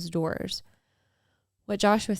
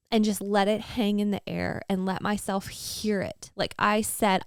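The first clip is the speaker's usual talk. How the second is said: normal talking voice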